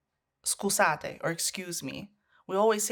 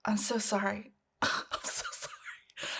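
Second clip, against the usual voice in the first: fading apologies; 7 dB quieter than usual. Retold or imitated. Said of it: "she'd be like".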